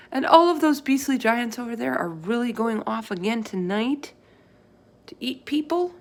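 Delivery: put-on a little girl asking in alarm. Sophie asking the giant in a worried girl's voice.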